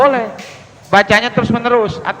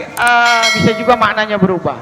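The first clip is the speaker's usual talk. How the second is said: usual speaking voice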